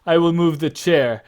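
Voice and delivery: a big jolly father amused by his son; father laughing off the boy's eagerness, loud and high; Pericles laughing. Acted. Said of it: "He's like".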